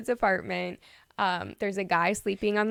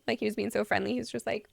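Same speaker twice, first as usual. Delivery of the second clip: ordinary talk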